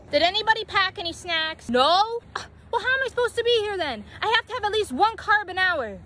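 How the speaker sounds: loud, high whiny complaint; loud whining. Acted. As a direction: whine like a spoiled teen. Whining about no snacks.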